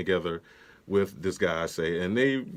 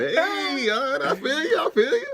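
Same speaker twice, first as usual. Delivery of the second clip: playful put-on voice; slower than usual. Playful riffing after the impression.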